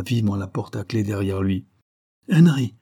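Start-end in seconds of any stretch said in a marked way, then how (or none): 2.3-2.7 high, loud cry of a name; sharp upset exclamation; distraught cry. A narrator's reading. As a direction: cry out, shaken, pitch falling. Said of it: "m'écriai-je bouleversé".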